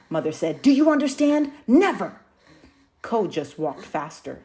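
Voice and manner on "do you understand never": much higher, louder stern rebuke; stern scolding. Acted. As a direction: make her never forget it.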